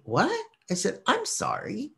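high, startled disbelief; baffled. Retold or imitated. Vlogger recalls answering the shopper with high startled confusion.

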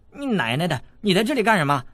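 put-on a street kid snapping back at the intruder; cheeky boy cutting in. A narrator's reading. Wei Xiaobao cuts in on the girl below the window, brash. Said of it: "韦小宝接口道".

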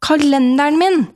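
put-on child calls back to dad about the calendar; calling back. A narrator's reading. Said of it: "roper jeg tilbake".

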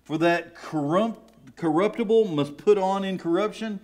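building from measured reading to high, loud fervour; preaching scripture. Acted. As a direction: read it like a preacher lifting the church. Reads scripture with rising fervour.

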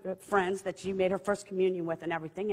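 ordinary talk, nothing marked

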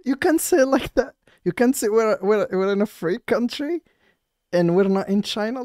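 high, slow, whiny parody of the cop; mocking the officer. Mocking the officer to his viewers, high whiny parody. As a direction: sneer it back in a squeaky prissy voice.

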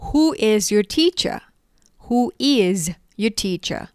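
slow, each word apart; slow model question. Shown as a demonstration. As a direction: model it slowly for the class. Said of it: "คุณครูของคุณคือใครหรอ?". (พูดช้าๆ ทีละคำ)

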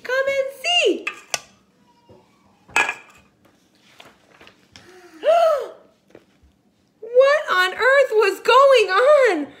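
high and loud storytelling alarm; dramatic alarm. Acted. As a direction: gasp through it as if it's a mystery. Excited dramatic read-aloud.

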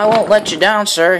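steamed eager vow; eager promise. A narrator's reading. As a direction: pitch it up, keen to please. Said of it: "he steamed".

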